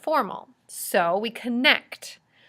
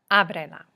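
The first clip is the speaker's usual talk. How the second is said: Ábrela with the opening A stressed; stress on first syllable; 3 semitones lower than usual. Shown as a demonstration. Teacher modelling an accented command, Ábrela stressed on A. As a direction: hit the A of Ábrela, then let it fall. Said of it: "add an accent over the A".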